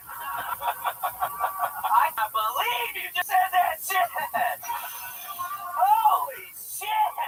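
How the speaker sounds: high, drawn-out cry of disbelief; shocked outcry. Acted. Shocked reaction to the affair confession.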